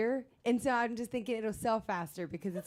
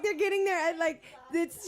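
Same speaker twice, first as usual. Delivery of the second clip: normal talking voice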